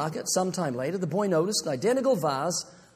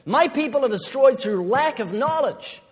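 loud and emphatic, higher; forceful quote; 5 semitones higher, 5 dB louder than usual. Shown as a demonstration. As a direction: proclaim it loudly. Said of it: "You never hear anyone quote the whole verse".

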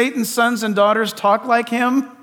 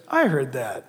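a deep, hushed divine approval; God pleased; 7 semitones lower, 9 dB quieter than usual. Retold or imitated. Preacher voicing God pleased with the cry, hushed low. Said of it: "God says".

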